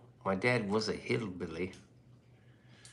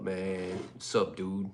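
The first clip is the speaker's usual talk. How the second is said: ordinary talk, nothing marked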